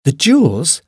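high-pitched astonished question; surprised. A narrator's reading.

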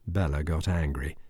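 plain reading voice

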